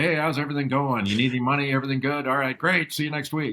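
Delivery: quick upbeat dad. Retold or imitated. Dad rushing through a long-distance call, cheerful. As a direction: rattle through it like a hurried dad. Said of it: "my dad get on and say".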